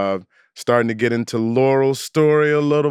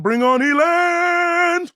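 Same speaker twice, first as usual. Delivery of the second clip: drawn-out, higher-pitched announcer call; excited guest introduction; much slower than usual. Real, not acted. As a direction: stretch the name out to bring the guest on.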